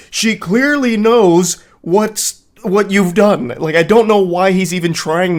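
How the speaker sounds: exasperated outburst. Real, not acted. Exasperated at the boyfriend's texts.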